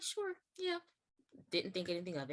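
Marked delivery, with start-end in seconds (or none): 0.0-0.8 higher and softer, breezy and unsuspecting; casual reply. Retold or imitated. Answering the director at the door, a soft, high, breezy yes. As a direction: toss off a light, easy yes.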